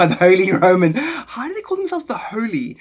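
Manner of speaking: loud, high, laughing exclamation; incredulous laughing. Real, not acted. Streamer mocks the Holy Roman name, incredulous laughing. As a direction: laugh through it, pitch leaping up.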